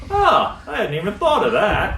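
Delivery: high, sudden gasp of surprise; as if it suddenly dawned on them; surprised realization. Retold or imitated. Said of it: "They're like".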